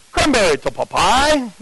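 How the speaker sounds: put-on light Jamaican accent, a radio call to Popeye; a hint of a Jamaican supervisor calling over the radio. Jamaican camp boss radios his partner, in a lightly put-on Jamaican accent. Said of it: "So he had this accent".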